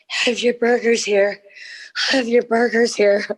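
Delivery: re-enacted struggle to keep hands steady; straining. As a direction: say it struggling under a heavy tray. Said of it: "My hands were like".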